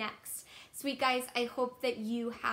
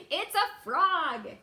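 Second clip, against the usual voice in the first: laughing reveal; 5 semitones higher, 7 dB louder than usual. Acted. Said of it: "That's my, that's my impression".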